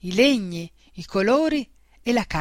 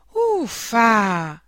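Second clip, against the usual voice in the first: insistent huff. A narrator's reading. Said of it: "ribadì lei".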